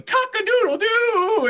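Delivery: long, high rooster crow; yelling. Acted. Yelling to attract Bigfoot in the woods, high mock rooster yell. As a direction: crow it out in a drawn-out falsetto yell. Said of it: "I started yelling".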